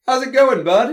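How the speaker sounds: high, loud hearty greeting; brother's greeting. Acted. Big brother greeting his sibling at the door, high and hearty.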